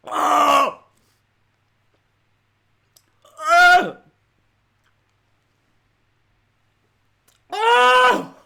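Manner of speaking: loud, high yelps of pain; repeated high "oh" moans in agony; pained cries. Real, not acted. Crying from the sour taste.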